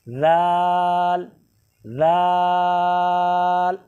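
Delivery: लाल held very long and thin, no whistle; drawn-out thin letter. Shown as a demonstration. Teacher demonstrating the thin letter, long slow hold. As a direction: stretch लाल out slowly and thinly. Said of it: "सीटी भी नहीं, बारीक है".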